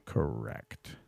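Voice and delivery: very low, slow, soft "correct"; deadpan catchphrase. Shown as a demonstration. Host answering a made-up question, very low deadpan. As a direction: sink to the bottom of your range, drawn out. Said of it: "I would say".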